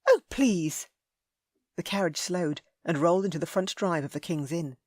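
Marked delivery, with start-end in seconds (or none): 0.1-0.9 high, loud dismissive scoff. A narrator's reading. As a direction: brush him off with disdain. Ellie scoffing.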